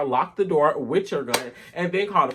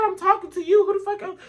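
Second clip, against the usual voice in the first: incredulous outburst. Retold or imitated. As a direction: fling it back at him, shocked he even asked. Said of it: "I said".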